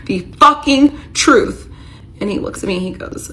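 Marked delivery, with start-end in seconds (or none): 0.0-1.7 high, slow furious scream. Retold or imitated. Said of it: "I scream in his face".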